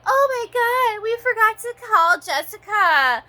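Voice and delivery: high, loud, panicky mock voice; mocking the company staff. Mocking company staff who forgot to call, high panic. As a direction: squeal it like a flustered clerk. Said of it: "they're like".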